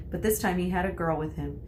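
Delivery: ordinary delivery, nothing marked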